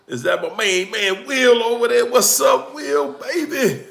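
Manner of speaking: excited shout-out to a friend; excited greeting. Real, not acted. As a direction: greet your main man across the room.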